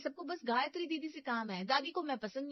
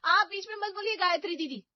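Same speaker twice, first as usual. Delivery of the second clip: loud, higher angry outburst; shouting at the elder sister-in-law; 5 semitones higher, 8 dB louder than usual. Acted. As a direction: yell at her to stay out of it. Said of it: "Anjali चिल्लाना शुरू कर देती है".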